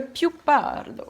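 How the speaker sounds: a touch of Italian accent. Real, not acted. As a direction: say it with an Italian lilt. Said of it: "je le dis avec l'accent italien".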